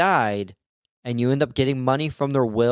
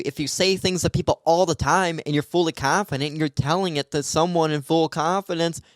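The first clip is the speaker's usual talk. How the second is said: ordinary talk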